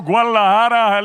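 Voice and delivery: ordinary delivery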